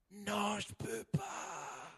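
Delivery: barely audible refusal. Acted. Lantern refuses.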